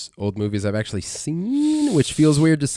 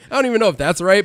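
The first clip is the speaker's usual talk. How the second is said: usual speaking voice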